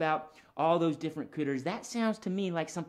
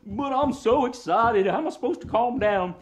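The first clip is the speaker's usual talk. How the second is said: raised, louder puppet dog voice; excited puppet dog; 6 semitones higher, 6 dB louder than usual. Pretend. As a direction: protest like a worked-up dog. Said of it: "He gets really excited sometimes".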